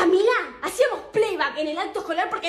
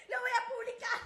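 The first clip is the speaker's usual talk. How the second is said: mustache twirling villain; 8 semitones higher, 10 dB quieter than usual. Acted. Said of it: "wicked glee publishing photo".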